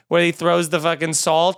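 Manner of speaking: ordinary talk, nothing marked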